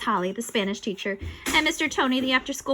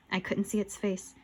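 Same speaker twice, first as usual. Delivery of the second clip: ordinary delivery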